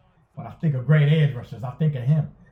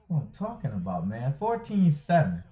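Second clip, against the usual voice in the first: normal talking voice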